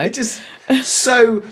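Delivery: ordinary talk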